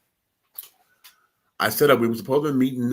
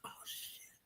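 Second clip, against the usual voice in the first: breathless whispered oh shit; hushed astonished aside; shocked whisper; 30 dB quieter than usual. Retold or imitated. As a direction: whisper it in stunned disbelief. Stunned whisper after the kiss.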